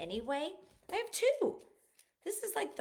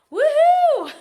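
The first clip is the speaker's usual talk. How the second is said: much higher, much louder woohoo; excited cheer; 21 dB louder than usual. Real, not acted. Proud mother cheering her son's wedding date, real joyful whoop. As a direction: whoop with joy for the news.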